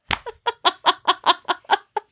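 high, breaking-up laughter; real laugh. Real, not acted. Podcaster cracking up after cursing the crowd, laughing. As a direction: laugh helplessly at your own joke.

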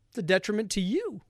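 normal talking voice

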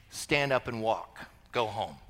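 low, hushed quoted command; imagined Jesus line. Retold or imitated. Crowd expects Jesus to heal the man, low and quiet. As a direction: say it low and soft, understated.

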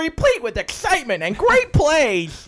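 high, loud hammed-up sports fan; sports bro voice. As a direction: read it like an excited jock. Mock sports-fan reading.

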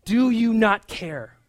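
loud, slow, accusing question; biting reproach. Retold or imitated. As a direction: demand an answer. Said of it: "such biting, uh, words for Jesus".

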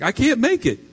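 hammed-up tearful protest; mock crying. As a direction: wail it like an upset wife. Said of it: "and she's crying".

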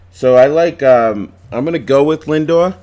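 loud and high, almost screaming. Real, not acted. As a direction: belt out the pick at full volume. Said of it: "I don't know why I'm screaming".

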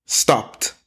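STOPPED as one syllable ending in T; correct -ed ending. Shown as a demonstration. Teacher models a tough ending, one-syllable stopped. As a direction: say "stopped" as STOPT. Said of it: "the E Ds are tough".

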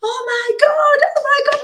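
very high squealing oh my God; a high-pitched delighted shriek from the guardian; excited squeal. Retold or imitated. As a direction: squeal it like the whole table just went mad. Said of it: "like, clapping, like, all mad".

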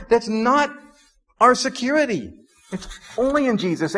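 high, ringing preacher's build toward the point; fervent pulpit proclamation rising in pitch; preaching at full force. Acted. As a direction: lift the congregation, proclaim it. Preaching climax on security.

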